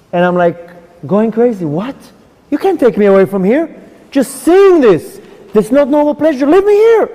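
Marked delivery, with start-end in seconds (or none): 1.6-7.1 desperate plea. Retold or imitated. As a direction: beg to stay, growing frantic.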